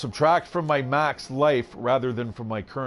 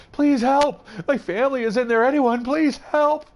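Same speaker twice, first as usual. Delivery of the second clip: high, raised-voice desperate cry for help; frantic pleading; 11 semitones higher, 3 dB louder than usual. Acted. Woman begging villagers to save her family, frantic pleading. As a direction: plead frantically as an acted character. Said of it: "frantically pleading to the villagers".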